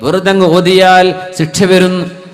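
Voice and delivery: sustained, projected sermon delivery; preaching. Acted. Preacher warning against reciting without reflection, preaching. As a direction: press the warning on a congregation, phrase by phrase.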